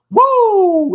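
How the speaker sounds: piercing high wrestler's woo, very loud; Ric Flair woo. Retold or imitated. Mocking showy Israelites, shouts a Ric Flair woo. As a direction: let rip a wrestling-star woo. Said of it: "They wanna be Ric Flair".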